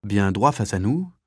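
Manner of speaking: usual speaking voice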